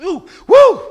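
high, loud whoops; imitated ticklish squeal. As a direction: whoop like someone being tickled underfoot. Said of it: "She was kicking".

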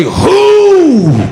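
"Who?" high, long and called out; emphatic WHO. Shown as a demonstration. Preacher showing congregation the response, "Who?" high and emphatic. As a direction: call out "Who?" on a high held note. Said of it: "You supposed to say".

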